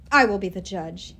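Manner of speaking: low, loud snarling pronouncement. A narrator's reading. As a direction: snarl it with cold authority. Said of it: "he snarled".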